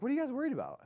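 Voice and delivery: Jesus untroubled. Retold or imitated. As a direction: voice Jesus, who is about to die on the cross yet is untroubled, up high. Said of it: "And he's like".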